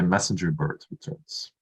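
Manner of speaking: ordinary talk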